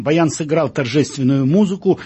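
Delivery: ordinary delivery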